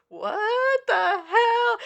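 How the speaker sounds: high, loud, drawn-out incredulity; stunned disbelief. Retold or imitated. Film crew gaping at the actor's trick, stunned. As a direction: stretch it out in mock astonishment.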